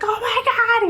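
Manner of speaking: excited husband. Retold or imitated. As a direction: burst out like a thrilled husband. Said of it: "He's so excited".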